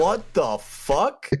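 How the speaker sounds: slow, drawn-out laughing disbelief; mock stunned reply, laughing. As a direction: stretch it out like you can't believe it. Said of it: "And I was like".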